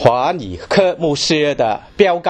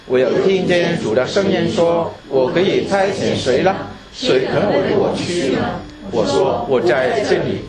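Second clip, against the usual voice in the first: scripture reading; 2 semitones higher than usual. Acted. Reading Isaiah 6 aloud.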